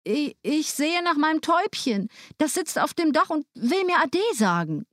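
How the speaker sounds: put-on the boy excusing himself sweetly; young Hänsel. A narrator's reading. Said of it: "antwortete Hänsel".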